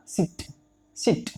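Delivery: short, quiet सिट, vowel barely held. Shown as a demonstration. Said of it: "छोटी sound है सिट".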